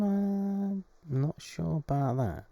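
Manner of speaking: high mock doubt; doubtful. Real, not acted. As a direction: sound sceptical of the pick. Doubting a surprise pick.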